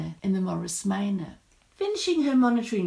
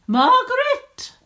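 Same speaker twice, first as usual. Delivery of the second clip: high, loud, drawn-out name call; shrill teacher; 9 dB louder than usual. A narrator's reading. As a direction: call a pupil sharply to attention. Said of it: "their teacher's shrill voice".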